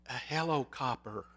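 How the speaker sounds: put-on soft, hushed child on the phone; gefluisterd kinderstemmetje. A narrator's reading. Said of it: "answered the whispering voice".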